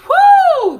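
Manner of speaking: very high, loud woo; ecstatic woo. Pretend. Vlogger miming Tiffany's bliss, loud high woo. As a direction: shriek it out in mock rapture.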